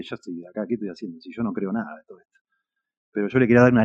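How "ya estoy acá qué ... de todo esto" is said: muttering to himself. Retold or imitated. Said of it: "yo en realidad dije".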